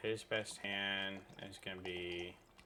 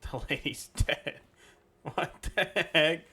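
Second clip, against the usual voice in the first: higher, louder, slow amazed laugh; laughing disbelief; 9 semitones higher, 11 dB louder, slower than usual. Real, not acted. Gamer reacts to the lady dying, laughing in disbelief. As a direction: laugh out the shock.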